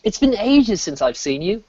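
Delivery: put-on a light British accent on the phrase; mock British, stretching ages. Imitating a Brit greeting a friend in a British accent. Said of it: "British people told me to stop".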